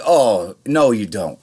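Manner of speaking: loud, indignant pushback at the boss; retold snap back, loud; mock-indignant loud no; indignant retort.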